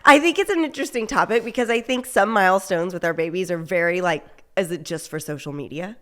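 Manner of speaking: upbeat show intro over music; podcast intro read. Acted.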